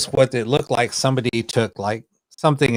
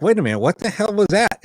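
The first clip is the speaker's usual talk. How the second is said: retold startled question, pitched up; mock-startled, higher voice; startled reaction; 7 semitones higher than usual. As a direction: act out doing a double take, higher. Retells startled reaction to playback.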